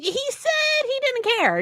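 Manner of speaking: high, slow, cry of disbelief; can't believe it; incredulous. Real, not acted. Reader venting about the hero, incredulous high cry. Outraged about bro code.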